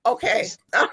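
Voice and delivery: chuckling through the words, amused; laughing. Real, not acted. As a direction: laugh lightly as you react. Host laughs at guest's answer.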